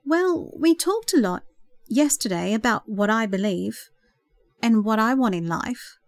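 usual speaking voice

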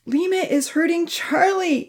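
high-pitched alarmed cry. A narrator's reading. Amy sees Charlie being hurt, crying out. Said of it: "Amy cried out".

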